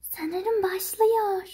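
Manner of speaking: usual speaking voice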